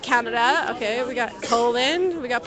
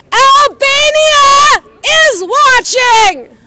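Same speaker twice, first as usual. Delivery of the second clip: shouting; 12 semitones higher, 11 dB louder, much slower than usual. Real, not acted. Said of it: "Damn, I'm gonna lose my voice".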